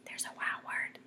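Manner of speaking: whispered aside. Real, not acted. Teacher whispers to the class about the word, whisper. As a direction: lean in and whisper it. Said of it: "whispered aside to class".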